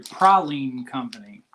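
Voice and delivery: "praline" as PRAH-leen; self-corrected pronunciation. Shown as a demonstration. Correcting himself on the company name, "praline" as PRAH-leen. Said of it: "I'm sorry".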